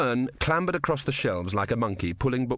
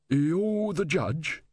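higher, very drawn-out question; questioning soldier; 7 semitones higher, much slower than usual. Acted. Newly dead soldier addressing Death, slow wondering question. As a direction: ask it slowly, in character.

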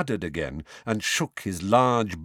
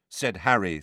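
ordinary delivery, nothing marked

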